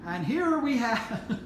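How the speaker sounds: high, drawn-out Texas drawl breaking into laughter; attempted Texas accent. Shown as a demonstration. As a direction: put on a Texas accent and crack up. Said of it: "try and do my Texas accent".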